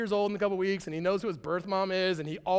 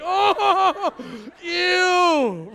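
mock disgust; 8 semitones higher, 9 dB louder than usual. Reacting to huge newborn.

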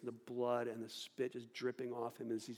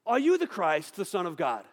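loud, pressing high-pitched demand; impatient high priest pushing loudly; exasperated demand; 3 semitones higher, 12 dB louder than usual. Retold or imitated. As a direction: corner him and force the answer out. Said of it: "Caiaphas is like".

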